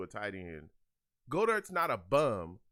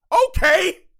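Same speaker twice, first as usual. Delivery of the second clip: sudden much higher, much louder joyful yell; excited shout; 19 dB louder than usual. Real, not acted. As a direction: explode with joy at the big play. Big play by his team.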